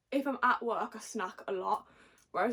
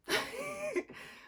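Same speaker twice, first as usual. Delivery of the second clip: tipsy high giggle. Real, not acted. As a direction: giggle at herself. Laughs at her own answer.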